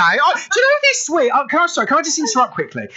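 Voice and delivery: squealing high with emotion, words tumbling out; gush, pitch flying up, barely holding it together; overwhelmed and touched. Real, not acted. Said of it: "I'm so touched today".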